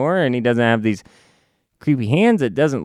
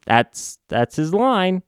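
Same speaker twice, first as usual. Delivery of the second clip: ordinary talk, nothing marked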